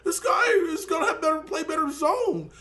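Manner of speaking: high, drawn-out mock whine of an online troll; dead-serious trolls. Mimicking trolls who argued over card speed, mock-earnest whine. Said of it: "like, dead serious that".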